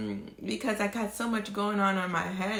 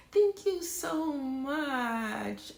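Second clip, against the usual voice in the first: heartfelt slow thanks; 6 semitones higher, much slower than usual. Real, not acted. Thanking viewers again, drawn out.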